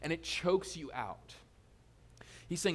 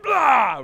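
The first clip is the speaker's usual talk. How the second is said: sudden loud mock yell; mock outburst; 8 semitones higher, 16 dB louder than usual. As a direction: blurt it like road rage, hammed up. Outburst when cut off driving.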